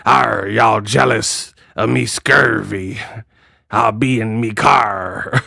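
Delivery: slow mock pirate with rolling arrs; hammed-up pirate drawl; Clarissa Shields. YouTuber mocks a boxer bragging, pirate impression. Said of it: "She be like".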